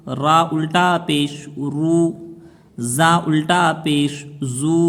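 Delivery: slow, measured Quranic spelling chant; recitation drill. Shown as a demonstration. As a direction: draw out each long vowel on a level tone. Leads repeat-after-me recitation drill. (धीमे, लय में हिज्जे का सबक)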